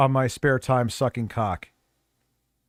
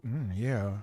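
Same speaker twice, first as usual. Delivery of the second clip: sassy voice; 5 semitones lower, 5 dB quieter than usual. Shown as a demonstration. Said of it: "I could just go".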